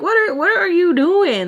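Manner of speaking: high, exasperated question; annoyed. Retold or imitated. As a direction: retell it in rising annoyance. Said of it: "I was so annoyed".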